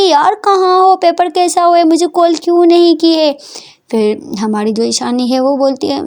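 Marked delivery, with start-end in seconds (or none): 0.0-3.3 high, louder, impatient friend on the phone; eager impatient caller. Acted. Prita calls Ishani and asks about the exam, high and impatient. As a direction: demand where she's been.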